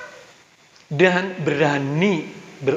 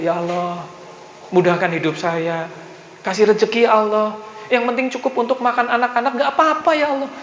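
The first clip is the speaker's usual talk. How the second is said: mock modest prayer; 7 semitones higher than usual. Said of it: "minta kok dikit gitu".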